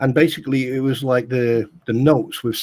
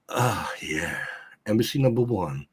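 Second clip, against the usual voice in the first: low, slow satisfied sigh of pleasure; smug contentment; 6 semitones lower, 6 dB quieter, slower than usual. Retold or imitated. Gloating to mates in camp, low and contented. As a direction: savour it low like the first drag. Smug with cigarette while others struggle.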